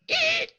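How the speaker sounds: very high, loud startled eek; dwarf squeaks in fright at the word troll; frightened squeak. Acted. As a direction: squeal like a scared mouse.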